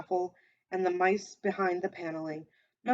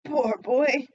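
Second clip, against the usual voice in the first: pitying cry; 5 semitones higher, 6 dB louder than usual. A narrator's reading. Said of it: "in the pity for his former self".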